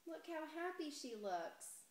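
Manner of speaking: usual speaking voice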